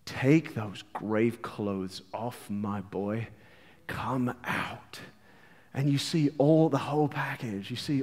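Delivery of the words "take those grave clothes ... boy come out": much softer and lower, slow; tender Jesus. Acted. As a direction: call the dead boy gently out. Jesus speaking to Lazarus.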